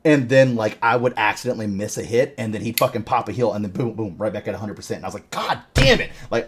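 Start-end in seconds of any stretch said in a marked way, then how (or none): 5.3-6.1 a much higher, exasperated mock curse; mock frustration. Retelling own frustration in duel.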